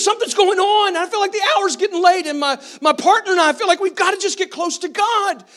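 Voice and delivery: lightly voiced nervous young man; anxious young man. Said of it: "He says".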